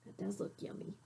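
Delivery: whispered, craving; longing whisper. Acted. Child admiring the pie.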